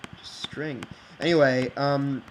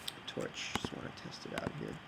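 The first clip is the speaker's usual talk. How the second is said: whispering; 16 dB quieter than usual. Real, not acted. Muttering to himself while testing.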